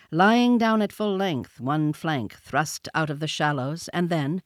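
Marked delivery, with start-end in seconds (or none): none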